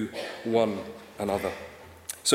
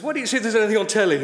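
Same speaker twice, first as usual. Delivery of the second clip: dad's suggestion; 7 semitones higher, 4 dB louder, much faster than usual. Retold or imitated. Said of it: "He says".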